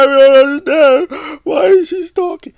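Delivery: hammed-up childish whine; mock crybaby whine. Vlogger mocks Aaron crying about stalking, in a whining voice. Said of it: "crying like a little guy".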